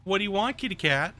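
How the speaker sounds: high, sing-song voice for the cat; pet talk. Real, not acted.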